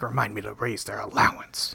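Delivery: put-on dad quipping about the kids; deadpan whisper. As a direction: breathe it like a dry joke. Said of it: "he's like".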